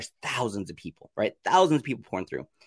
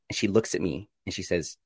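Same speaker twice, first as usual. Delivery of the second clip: usual speaking voice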